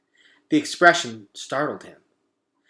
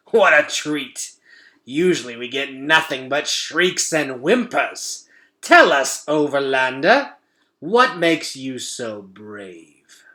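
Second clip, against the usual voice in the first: louder, amused rat voice; taunting rat; 2 semitones higher, 6 dB louder, slower than usual. Acted. Shed taunting the boy, amused and loud. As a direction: toy with your prey.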